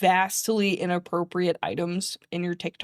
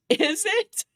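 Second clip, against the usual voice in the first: usual speaking voice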